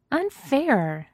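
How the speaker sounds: high, pouting unfair; pouty grumble. A narrator's reading. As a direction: pout it out like a sulky kid. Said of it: "she grumbles".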